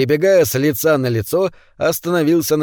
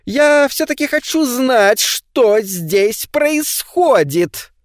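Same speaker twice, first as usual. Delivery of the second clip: pompous neighbour, voiced higher; self-important; 10 semitones higher, much slower than usual. A narrator's reading. Charlie rallying the neighbours, pompously. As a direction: puff up like a man taking charge. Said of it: "важно заявил Чарли Франсуорт".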